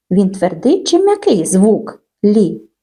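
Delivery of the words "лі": лі said with a palatal L, like "lee"; soft L. Shown as a demonstration. Teacher asks children hard or soft, лі said soft. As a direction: say лі softened, as a lone sound. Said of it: "Так, м'який".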